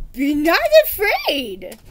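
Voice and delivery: slow, loud, gallant reassurance; calm them with slow knightly assurance; a knight reassures frightened peasant children, slow and loud; reassuring knight. Acted. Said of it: "Sir Fartsalot assured them".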